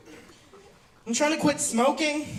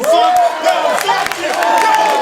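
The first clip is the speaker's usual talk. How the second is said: much higher and louder shouting at the crowd; loud mock outburst; 15 dB louder, much slower than usual. Real, not acted. Comic yelling at the crowd for not clapping, mock outrage. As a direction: yell it at them in joking outrage. Scolding the audience jokingly.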